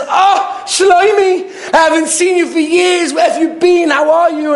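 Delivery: high, hearty call of welcome; rich friend's greeting. Retold or imitated. Rich friend greets old yeshiva friend.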